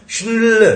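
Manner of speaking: shnl said as one cluster, no vowel between sh, n and l; blended shnl. Shown as a demonstration. Teacher to class, blending the ending. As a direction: glide shnl together unbroken. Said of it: "तीनों मिलके बिना तोड़े shnl".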